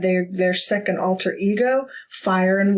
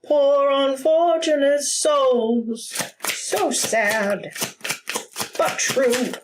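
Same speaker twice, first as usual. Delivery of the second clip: sea witch; 6 semitones higher, much slower than usual. Pretend. Quoting a sea witch song.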